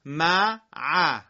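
«ل، ک» as two separate loud syllables; syllables split apart. Shown as a demonstration. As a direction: break the word into its beats for the class. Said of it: "به صورت تفکیک میخونم".

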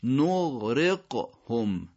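نغرقهم very slowly, drawn-out gh then crisp q; ghayn, then qaf. Shown as a demonstration. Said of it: "قید دوم قافه". (نغرقهم کشیده، اول غین و بعد قاف)

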